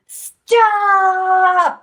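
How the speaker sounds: loud, high, long-held shout; yelling lion. A narrator's reading. Lion has had enough of makeovers, yelling. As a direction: bellow one word and stretch it out. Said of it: "yelled the lion".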